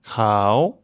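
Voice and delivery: "Krao" low then rising. Shown as a demonstration. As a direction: lift the pitch through "Krao". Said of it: "fait un ton montant".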